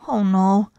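plain reading voice

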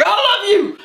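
very high, swooning declaration; hammed-up imitation of instant infatuation; mock gushing love. Mimicking catfishers' instant declarations of love.

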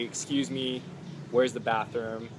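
ordinary talk, nothing marked

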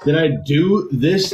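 normal talking voice

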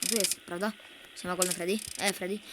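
usual speaking voice

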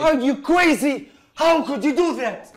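slow, loud and indignant; angry outburst. Acted. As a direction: hurl the accusation at him. Roommate quarrel over ruined article.

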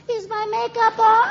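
very high, drawn-out squeal; high-pitched comic character; put-on character voice. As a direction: squeak it slowly, high above your voice. Mock question in character.

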